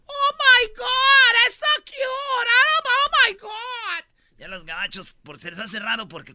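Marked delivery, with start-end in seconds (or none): 0.1-4.1 much higher, louder, slow squeal; shrieking mock fan over a cute dog; gushing Americans. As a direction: squeal like a smitten tourist. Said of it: "y todos".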